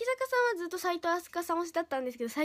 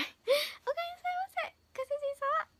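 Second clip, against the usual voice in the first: high sweet maid welcome; sugary maid cafe greeting; 11 semitones higher, faster than usual. Acted. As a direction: chirp it like a maid cafe girl. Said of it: "めっちゃ暗い。".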